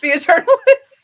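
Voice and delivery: laughing the word out, high and loud; giggling at the absurd ending. Real, not acted. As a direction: break into a laugh mid-word.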